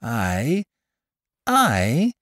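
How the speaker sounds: high, rising mock-innocent query; exclaimed. A narrator's reading. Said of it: "merely ejaculated".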